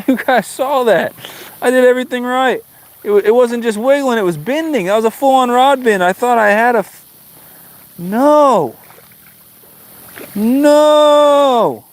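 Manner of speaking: very high, pained protest to viewers; telling viewers it was a real bite; agitated lament. Real, not acted. Recounting lost fish, agitated.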